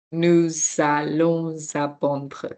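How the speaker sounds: "Nous allons apprendre" slow, both S's linked as Z; double liaison. Shown as a demonstration. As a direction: stretch out "Nous allons apprendre", sounding "nou-zallon-zapprendre". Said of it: "you can hear the sound of the S".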